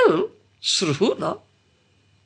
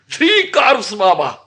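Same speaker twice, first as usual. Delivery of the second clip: laughing; 4 semitones higher, slower than usual. Real, not acted. Joking about Krishna's curves.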